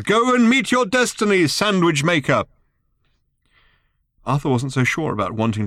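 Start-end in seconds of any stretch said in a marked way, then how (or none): 0.0-2.4 shouting. Acted. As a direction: proclaim it at full voice. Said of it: "shouted Thrashbar".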